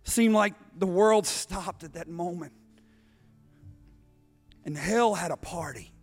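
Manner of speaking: low, soft, weighty narration; hushed solemn preaching. Real, not acted. Preacher telling the congregation of the crucifixion, hushed and solemn. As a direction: drop to a grave hush with long pauses.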